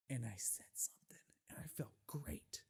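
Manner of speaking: unvoiced whispered aside. Real, not acted. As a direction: drop to a secret whisper.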